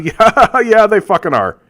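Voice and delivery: high laughing yeah, they fucking are; laughing retort. Real, not acted. Ranting about climate deniers, laughing scornful retort. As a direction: scoff with a laugh and fire back.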